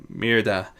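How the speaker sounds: ordinary delivery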